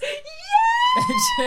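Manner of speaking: very high gleeful "Yay!"; cheering yay. Retold or imitated. Monster gets the kid, gleeful cheer. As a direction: squeal it with glee. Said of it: "I wrote in my notes".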